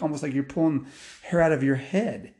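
usual speaking voice

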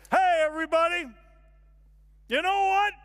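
high, loud outburst to a whole room; shouted confession. Acted. Man finally gets courage to address the room, shouting. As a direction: shout to get everyone's attention. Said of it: "I finally get up the courage to".

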